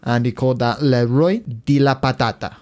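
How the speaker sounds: ordinary delivery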